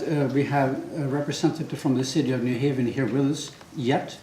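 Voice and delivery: ordinary delivery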